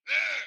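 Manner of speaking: sudden loud high "Ah!" yell; grunted yell. Retold or imitated. Co-star answering the door knock with a loud wordless yell. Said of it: "Like, "Hey, you wanna run scenes?".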